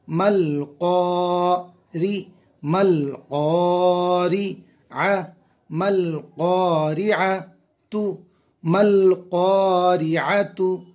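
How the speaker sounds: मल क़ारिअतु built slowly with lam joined to mim; slow joined recitation. Shown as a demonstration. Teacher builds the word for students, slow chant. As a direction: chant मल क़ारिअतु drawn out, linking the letters. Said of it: "लाम को मीम से मिलाएंगे".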